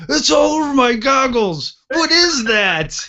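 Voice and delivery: hammed-up high wail of disgust; retold screaming. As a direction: imitate a horrified gunner screaming. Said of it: "the T C screaming".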